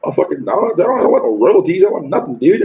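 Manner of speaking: ordinary talk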